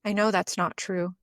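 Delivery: deep inner voice. Acted. Intuition answering the inner saboteur, lightly deepened and measured. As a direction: lightly voice a steady inner knowing, with long pauses. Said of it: "the deep voice would come in and go".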